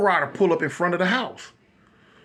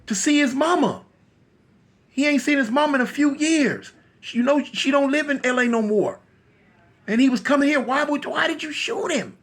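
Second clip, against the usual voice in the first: higher-pitched, upset explaining; distraught mama; 6 semitones higher than usual. Acted. KC's mama telling him who Capone was, high distraught reproach. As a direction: explain it high, building to an anguished question.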